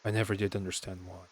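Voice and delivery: ordinary talk, nothing marked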